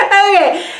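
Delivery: high burst of laughter; laughing. Real, not acted. After the crowd shouts yes, laughing. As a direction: crack up then say okay.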